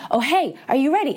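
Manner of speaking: the universe nudging harder now. Shown as a demonstration. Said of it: "it gets louder and louder".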